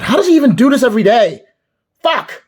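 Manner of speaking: high, fast furious yell; exasperated shout. Acted. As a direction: burst out shouting in frustration. Grumbling about a chore.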